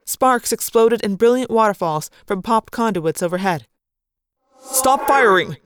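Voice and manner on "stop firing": loud high command; a commander cutting through the chaos; ordering. Acted. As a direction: bark the order to cease fire. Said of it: "Alaran ordered".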